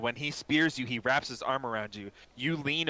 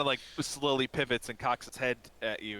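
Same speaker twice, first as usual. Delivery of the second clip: normal talking voice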